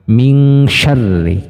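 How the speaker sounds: slow min syarri with nun as ng, miŋ; wrong nun as ng. Shown as a demonstration. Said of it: "Itu kurang tepat".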